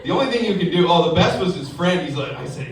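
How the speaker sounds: ordinary talk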